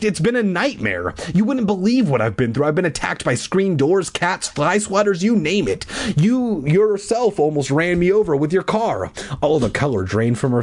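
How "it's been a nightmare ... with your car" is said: high, indignant mock rant; shrieking. A narrator's reading. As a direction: pile up the complaints in a raised pitch. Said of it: "I shrieked".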